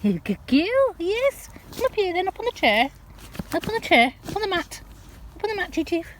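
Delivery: high, sing-song calling to the dogs; coaxing pet talk. Real, not acted. Owner coaxing Chief and Spirit out of the hedge, high sing-song encouragement. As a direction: coax them out, high and encouraging. Calling dog onto chair.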